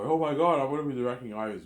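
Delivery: thrilled new hire, quick and high-pitched; acted overjoyed. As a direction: act out jumping for joy at getting the job. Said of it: "Mel's joy for joy".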